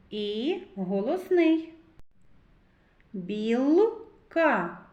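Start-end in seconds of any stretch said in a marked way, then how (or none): 3.1-4.8 "Білка" broken after a held Л; consonant singled out. Shown as a demonstration. Teacher singles out a sound for children, "Білка" with Л held. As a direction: hold the Л in "Білка", then add "ка". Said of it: "Л. Твердий приголосний".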